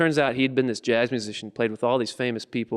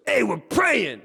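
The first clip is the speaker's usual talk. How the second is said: high, raised character voice; protesting shout; 10 semitones higher, much slower than usual. Acted. George answers the big guys outside the car, high protesting shout. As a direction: shout back in indignant explanation.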